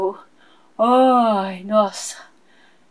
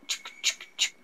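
whispered beat clicks; 13 dB quieter than usual. Acted. [name] mouths dance beat.

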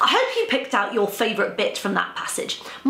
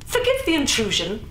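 smooth; 6 semitones higher than usual. Acted. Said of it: "Robert said smoothly".

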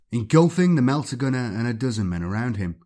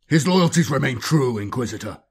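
firm, louder commanding captain; iron voice; 2 semitones higher, 4 dB louder than usual. Acted. Captain defending his librarian against the inquisitor, iron voice. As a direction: cut him off with iron authority. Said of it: "iron voice cut him short".

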